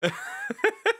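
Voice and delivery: high-pitched squeaky laughter; helpless laugh. Real, not acted. Streamer cracking up at a video, helpless laugh.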